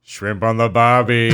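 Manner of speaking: loud, hammed-up Australian accent. Shown as a demonstration. Friend demands a redo of the accent, hammed-up Australian. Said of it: "you do an Australian accent".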